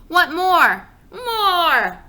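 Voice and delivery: high, loud crying demand; crying toddler. Acted. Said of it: "Fudge started crying".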